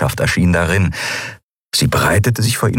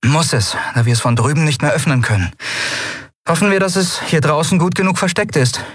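put-on higher, quick young captain pressing on; brisk captain; 9 semitones higher than usual. A narrator's reading. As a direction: hurry the crew along, lightly. Endriel voiced in story.

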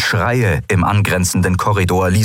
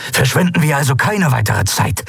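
higher, pressing voice; urging; 7 semitones higher than usual. A narrator's reading. Andalok urging colleagues to waste no time, pressing. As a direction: press them to act. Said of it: "drängte Andalok".

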